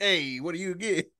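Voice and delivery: quick New Yorker hey; New York accent. Acted. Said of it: "The New Yorker?".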